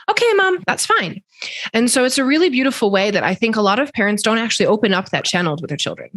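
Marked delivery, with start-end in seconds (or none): 0.1-1.2 put-on much higher, easygoing kid voice; child's reply. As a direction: pipe up like a relaxed kid agreeing. Mother voicing her child's reply.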